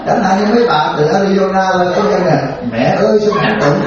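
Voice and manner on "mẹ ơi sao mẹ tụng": high, laughing, coaxing "Mẹ ơi"; butter her up like a fond child; sweetly calling mother. Pretend. Monk acting a devotee sweetly calling an old lady mother, high and laughing. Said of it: "Tôi nói là kiểu đó đó".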